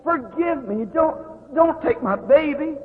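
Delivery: high, breaking plea, slow at the end; desperate plea. Retold or imitated. To God at the child's bedside, a high desperate plea. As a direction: beg God not to take the child. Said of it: "I said".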